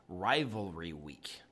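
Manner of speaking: Rivalry week with the R turned into W, slow and low; Rivalry said as rivalwy, slowly; says w for r. Real, not acted. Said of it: "'cause I can't say my R's".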